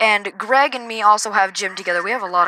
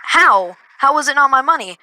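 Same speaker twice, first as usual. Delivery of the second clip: retold protest, "How" pushed louder; higher, incredulous comeback in a retelling; indignant challenge; 4 semitones higher, 4 dB louder than usual. Student disputes the teacher's verdict, indignant.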